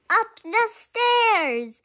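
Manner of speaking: high, slow drawn-out stairs; playful lilting read; sing-song rise. Acted. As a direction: stretch it up high like climbing. Child reading, voice leaps up.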